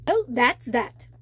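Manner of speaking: a hint of relief in a crab's voice; lightly voiced relieved crab. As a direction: close the matter with relief.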